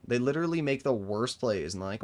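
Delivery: ordinary talk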